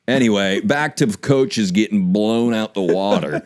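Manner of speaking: ordinary talk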